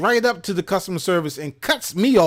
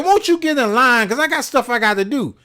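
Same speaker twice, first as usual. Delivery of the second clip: annoyed demand; 6 semitones higher, 3 dB louder than usual. Retold or imitated. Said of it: "I said".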